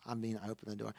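ordinary talk

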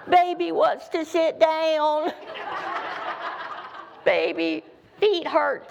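put-on high, slow baby-talk whine; hammed-up baby voice; whiny child. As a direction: whine like a tired kid. Tired child answering mama.

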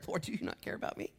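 breathy unvoiced prayer; a believer pleading with God; whispered plea. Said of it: "You ever prayed that prayer".

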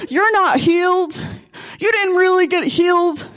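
mock the devil's nagging taunt; small, high imitated devil; blabbing little devil. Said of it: "the little guy just starts blabbing".

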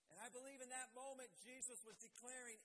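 ordinary delivery

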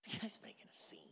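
low, barely audible whisper; whispered aside. Acted. Crowd member remarking on the beggar, whispering. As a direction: whisper it to the person beside you. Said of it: "you're like".

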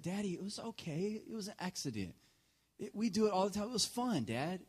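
put-on high, soft child's voice; sweet little boy. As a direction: reassure Daddy like a forgiving small son. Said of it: "how sweet Josiah is".

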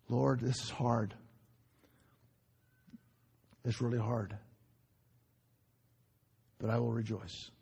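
low and soft, with long pauses; subdued, struggling prayer. Acted. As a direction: confess it quietly to the Lord. Acts out a hard prayer to God.

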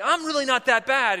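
high, loud, indignant protest; offended little maggot. Acted. Maggot standing up to the hiker, offended. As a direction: insist as an affronted tiny creature. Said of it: "one of the little maggots got offended".

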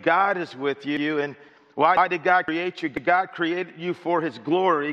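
ordinary talk, nothing marked

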